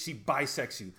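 ordinary talk, nothing marked

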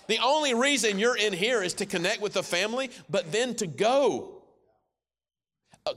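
loud fervent preaching, stretched and pounding; preaching at full force. Acted. Pastor urging his church to go out, loud and fervent. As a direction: drive it home to the whole room.

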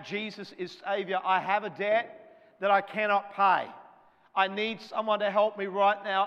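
crying out. Retold or imitated. Man crying to Jesus for help, loud plea. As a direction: plead loudly for rescue. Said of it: "He's crying out".

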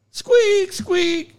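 squeak imitation. Shown as a demonstration. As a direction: squeak like a swinging chain. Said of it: "that squeak".

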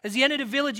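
ordinary talk

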